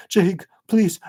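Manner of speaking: put-on a desperate child begging; tearful plea. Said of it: "as he cries".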